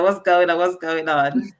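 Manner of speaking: ordinary talk, nothing marked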